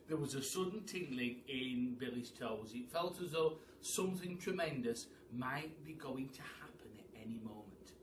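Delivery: usual speaking voice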